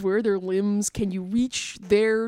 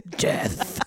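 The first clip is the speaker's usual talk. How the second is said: mock solemn; much slower than usual.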